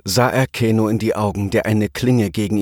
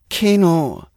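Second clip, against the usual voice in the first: high, falling plea of a name; a touch of a pleading princess; 9 semitones higher than usual. A narrator's reading. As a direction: beg him to stop. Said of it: "sagte Aya flehend".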